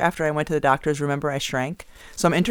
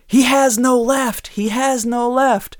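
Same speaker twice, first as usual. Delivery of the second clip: much higher, louder, drawn-out sideline jeer; taunting yell; 10 semitones higher, 8 dB louder, slower than usual. Shown as a demonstration. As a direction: taunt the opponent with a slow loud yell. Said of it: "basically taunting the opponent".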